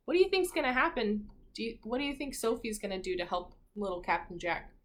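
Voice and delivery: plain reading voice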